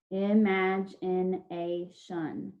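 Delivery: IMAGINATION slowly, split into five syllables; syllable breaks. Shown as a demonstration. Teacher reviewing syllable breaks, IMAGINATION slowly in separate parts. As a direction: leave a gap after each syllable of IMAGINATION. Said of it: "All of our syllable breaks between our syllables".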